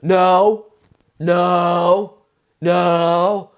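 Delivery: high, loud mock protest; loud girl protest. Drunk girl yells back at the comic, high and loud. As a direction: shout the girl's denial from the crowd.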